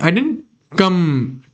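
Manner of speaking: ordinary delivery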